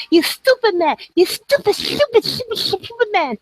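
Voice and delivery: high, shrill-pitched angry mock of Ronan; Ronan impression. Speaker mocking Ronan's angry insults, high-pitched mock rant. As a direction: squeal the insult like a mad friend. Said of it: "So I could do Ronan".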